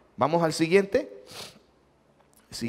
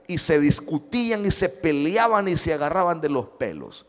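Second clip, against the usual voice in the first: usual speaking voice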